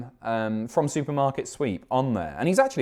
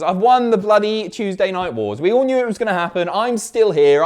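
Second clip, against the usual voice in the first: loud, higher, gleeful victory crowing; triumphant gloating; 8 semitones higher, 6 dB louder than usual. Acted. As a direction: crow about the win like a champion. Gloating over winning ratings war.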